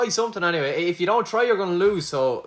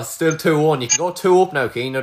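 ordinary talk, nothing marked

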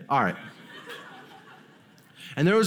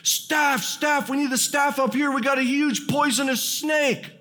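much higher mock yell for help; high, urgent mock shout for staff; retold scream; 9 semitones higher than usual. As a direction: call out like panicked teens. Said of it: "we hear this scream".

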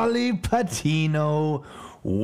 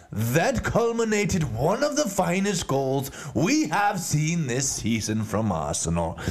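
normal talking voice

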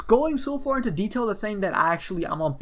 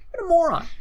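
exasperated scoff; 11 semitones higher, 4 dB louder than usual. Real, not acted. Scoffing at the troll's comment.

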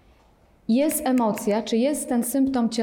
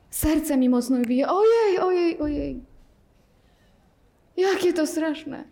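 mock panic; 6 semitones higher than usual. Teacher parodies a student's racing heart, hammed-up fear. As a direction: overplay the fear.

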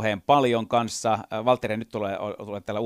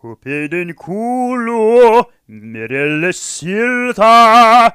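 very slow, higher, louder crooning voice; 12 semitones higher, 13 dB louder, much slower than usual. Retold or imitated. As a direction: stretch it out like a pop crooner. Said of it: "[name], joka on".

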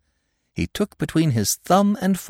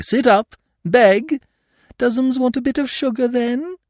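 much higher, coaxing pet talk, fast at the end; mocking baby talk; 14 semitones higher, 3 dB louder than usual. A narrator's reading. Lupin taunting the inspector as a dog, mocking baby talk. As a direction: talk down to him like a lapdog.

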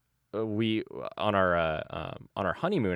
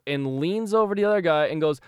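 usual speaking voice

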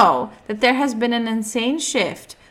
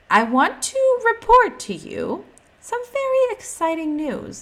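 high, bright news-anchor voice; mock announcer; 14 semitones higher, 3 dB louder than usual.

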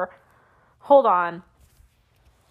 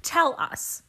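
whispered mock button voice; 4 semitones higher, 5 dB quieter than usual. Shown as a demonstration. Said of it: "I take that to mean".

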